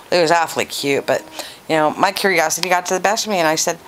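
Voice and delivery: ordinary talk, nothing marked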